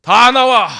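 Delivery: high, loud command; angry shout. Acted. Imagined Jesus yells at the hiding disciples, loud and angry. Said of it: "이런".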